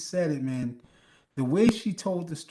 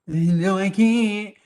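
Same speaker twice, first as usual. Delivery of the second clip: mock refusal; 5 semitones higher, 8 dB louder, slower than usual.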